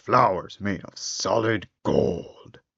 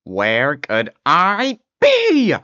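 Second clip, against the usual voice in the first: slow, high, loud cry; shouting; 7 semitones higher, 7 dB louder, much slower than usual. Acted. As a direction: wail it out in despair. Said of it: "he would shout".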